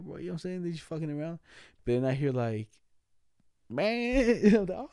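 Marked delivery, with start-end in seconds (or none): 3.7-4.4 high strained goat noise; imitated goat cry. Imitating the choking goat he heard from outside, strained and high. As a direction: squeeze out a high strangled animal cry.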